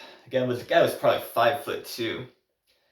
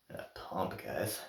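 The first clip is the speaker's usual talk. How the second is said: breathy, much softer strained words; strained from exercise; 4 semitones lower, 12 dB quieter, much slower than usual. Real, not acted. Vlogger straining through a workout set to his viewers, soft and strained. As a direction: push the words out mid-workout. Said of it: "Oh, this burns".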